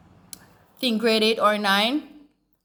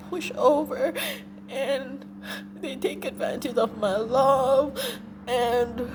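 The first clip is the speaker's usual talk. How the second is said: real crying; 4 semitones higher than usual. Real, not acted. Remembering her kind teacher and mean people, crying. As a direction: cry through the words, voice cracking. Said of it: "I'm getting emotional again".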